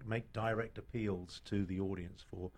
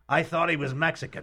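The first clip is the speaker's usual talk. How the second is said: high, loud audience member's remark; 7 semitones higher, 10 dB louder than usual. Retold or imitated. Elderly gentleman near the front to his companion, very loud voice. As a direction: imitate an oblivious loud theatregoer. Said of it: "in a very loud voice".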